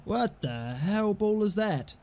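ordinary talk, nothing marked

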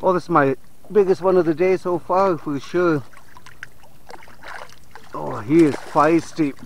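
usual speaking voice

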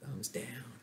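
hushed whispered "thumbs down". Shown as a demonstration. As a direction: whisper it to the class.

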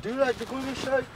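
high-pitched put-on Widowy voice; demonstrating the voice. Shown as a demonstration. Mate showing how to ask, high Widowy voice. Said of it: "Be like".

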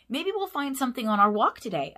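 put-on eager young pup suggesting a walk; lively puppy voice. A narrator's reading.

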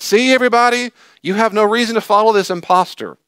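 loud, high, jeering call to the crowd; mocking public shout. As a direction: denounce him as a fraud to everyone, loud and high. Pharisees mock Jesus to crowd.

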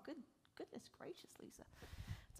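hushed amazement at the painting; whispered wonder; awed whisper. Real, not acted. Amazed at the stormy sailing painting.

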